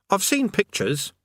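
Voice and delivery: high-pitched character voice; Eric. A narrator's reading. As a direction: pipe up with a high, knowing question. Eric continues in his voice.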